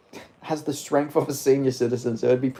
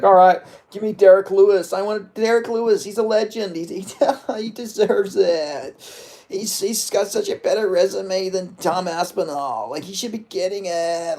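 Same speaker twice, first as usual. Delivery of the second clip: higher, put-on voice of a fighter angling for an easy matchup; mock heavyweight champ, raised voice with a laugh; John Jones impression; 6 semitones higher than usual. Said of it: "that's my John Jones".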